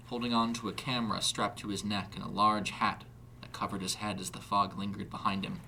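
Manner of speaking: ordinary delivery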